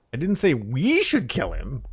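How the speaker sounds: "we" lifted high and loud with a rising tune; single out "we", as if it was someone else; stress on WE. Shown as a demonstration. Streamer showing chat the shifting emphasis, high stress on "we". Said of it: "put the emphasis on a different word".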